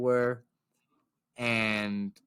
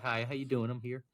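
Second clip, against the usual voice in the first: softly imitated polite customer; meek greeting; 7 dB quieter than usual. Customer actually greeting restaurant staff, meek and quiet. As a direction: drop to a small, quiet hello. Meek real version after bluster.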